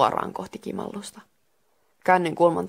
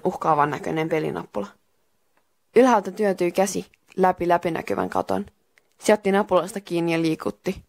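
ordinary delivery

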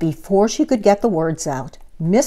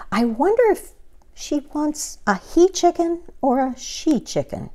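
puzzled; 9 semitones higher than usual. Acted. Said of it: "said Amelia Bedelia".

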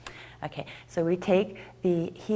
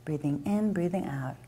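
calm, quieter guided yoga cue; soft yoga guidance; 6 dB quieter than usual. Acted. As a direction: ease the class gently into the pose. Guiding tree pose breathing.

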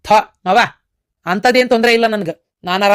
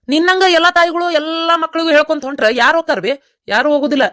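higher, insistent protest; heated pleading; 7 semitones higher than usual. Acted. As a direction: push back hard at mother. Son argues back at mother. (ತಾಯಿಯೊಂದಿಗೆ ಉತ್ಸಾಹದಿಂದ ವಾದಿಸುವುದು)

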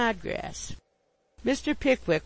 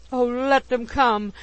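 slow, loud, higher outburst; frantic exclamation; 5 semitones higher, 6 dB louder than usual. A narrator's reading. Landlady refuses to let Pickwick go, frantic. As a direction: cry it out with reckless devotion. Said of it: "exclaimed Missus Bardell frantically".

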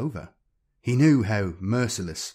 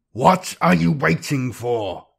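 slow, loud, raised-pitch tyrant's roar; 5 semitones higher, 7 dB louder, slower than usual. A narrator's reading. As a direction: roar it as an impatient warlord. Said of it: "roared the Blood Reaver".

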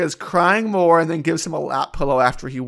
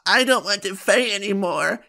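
higher-pitched panicked whine, hammed up; mock panic; 5 semitones higher than usual. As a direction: imitate a panicking girl giving up. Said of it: "she starts panicking and running away".

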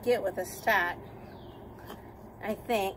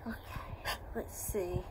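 whispering; 5 semitones lower, 10 dB quieter, slower than usual. Real, not acted. Whispering while handling animal.

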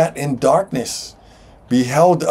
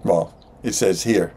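ordinary talk

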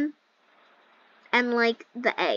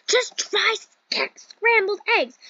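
high, drawn-out mock-mom voice; imitated mom; 8 semitones higher, 3 dB louder, slower than usual. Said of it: "she was like".

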